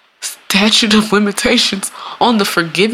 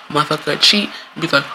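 usual speaking voice

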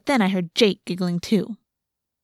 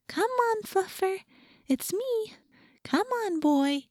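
very high and much softer coaxing; talking to animals voice; 11 semitones higher, 8 dB quieter, slower than usual. A narrator's reading. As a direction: plead sweetly with the cat in a tiny voice. Coaxing the wary cat.

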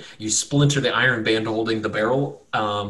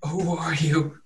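higher, halting tortured man; weary tortured captive; 6 semitones higher, slower than usual. Acted. Beaten young man addresses his rescuers, weary and higher. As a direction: ask it slowly like someone wishing for death. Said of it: "looks up at you guys really slowly, and he says".

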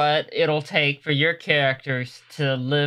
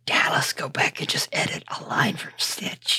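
hushed whisper; 3 dB quieter than usual. Real, not acted. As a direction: whisper it to the group. Whispered joke among players.

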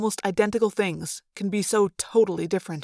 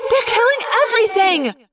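very high, loud wail of outrage; outraged cry; 13 semitones higher, 5 dB louder than usual. A narrator's reading. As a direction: cry out, appalled. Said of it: "Cassie cried, outraged".

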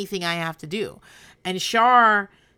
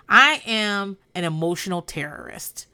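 ordinary talk, nothing marked

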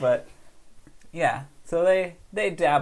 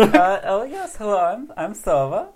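bright squeaky sing-song welcome; Sova character voice; 3 semitones higher, 5 dB louder than usual. Acted. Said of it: "did we figure out character voices".

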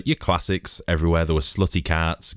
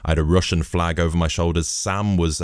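ordinary talk, nothing marked

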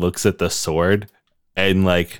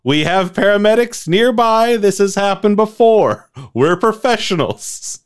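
high, loud stadium announcer; 12 semitones higher, 4 dB louder than usual. Pretend. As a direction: boom it out over the arena. Arena announcer addressing the crowd.

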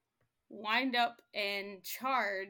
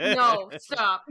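loud laughing protest; 17 dB louder than usual. Real, not acted.

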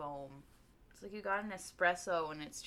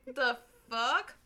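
normal talking voice

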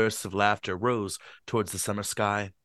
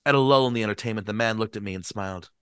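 plain reading voice

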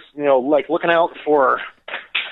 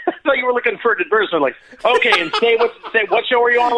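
mock ad plug; 9 semitones higher, faster than usual. Mocks a podcast ad plug.

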